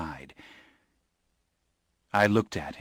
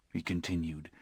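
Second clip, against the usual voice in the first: plain reading voice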